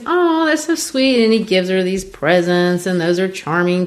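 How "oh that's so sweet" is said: high, slow swooning reply; sweet. Retold or imitated. Mama's daughter charmed by his nickname, high gushing voice. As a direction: gush like she's charmed. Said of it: "And she goes".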